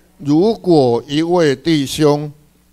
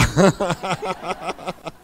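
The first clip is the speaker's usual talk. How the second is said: soft laugh; 3 semitones higher, 6 dB quieter than usual. Real, not acted. Laughing after the imitation.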